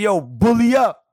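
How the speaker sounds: loud, slow, high shout across the arena; calling out. Retold or imitated. Fan yelling at the player, loud slow shout. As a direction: hype the player up from the stands. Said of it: "I was like".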